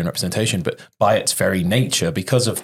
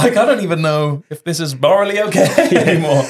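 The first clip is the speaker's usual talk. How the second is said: high, laughing all through; laughing at the absurdity, up high; 12 semitones higher, 5 dB louder than usual. Real, not acted. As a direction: giggle through the worry. Laughing about own accent.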